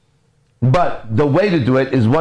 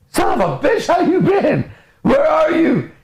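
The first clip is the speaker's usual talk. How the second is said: very high, frantic dog greeting; overjoyed dog; slower than usual. Acted. Dog greets owner back after two minutes, overjoyed. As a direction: squeal it like a dog reunited with its owner.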